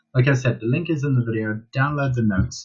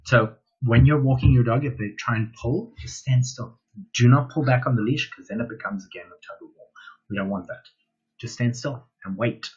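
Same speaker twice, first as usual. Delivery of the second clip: ordinary talk, nothing marked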